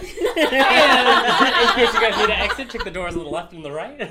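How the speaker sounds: high, slow, laughing announcement; hammed-up cabin safety announcement; flight attendant. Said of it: "You sounded like a flight attendant".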